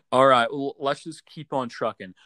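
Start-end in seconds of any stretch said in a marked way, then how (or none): 0.0-0.5 laughing it off. Real, not acted. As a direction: laugh through the words. Laugh after phone glitch.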